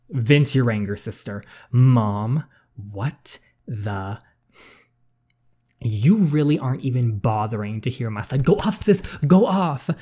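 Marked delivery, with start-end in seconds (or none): 1.7-8.4 louder, furious at mom for not listening; angry outburst. Acted. Daughter snaps at mother. 8.4-9.9 higher and louder, egging her on; hype cheer. Real, not acted. Reactor cheering on character.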